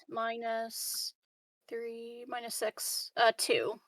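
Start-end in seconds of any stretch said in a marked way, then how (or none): none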